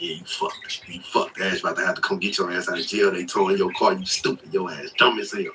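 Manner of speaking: low, hushed inner voice; soft, low talking-to-yourself; muttered self-berating. Retold or imitated. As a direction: retell private self-scolding under your breath. Replaying his self-berating inner voice.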